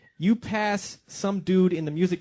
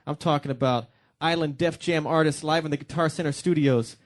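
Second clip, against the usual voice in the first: ordinary delivery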